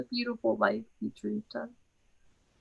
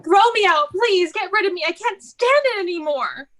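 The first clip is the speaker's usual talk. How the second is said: high, loud begging on the edge of tears; desperate pleading; 5 semitones higher, 4 dB louder than usual. Acted. Irina begs her sisters to get rid of her, high and pleading. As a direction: plead to be thrown out, raw and high.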